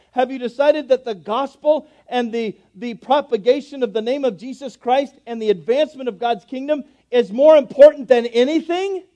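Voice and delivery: loud, high preaching that rises and booms; full-force preaching. Acted. Preacher booming God's promise at the congregation. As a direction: preach it out to the whole room.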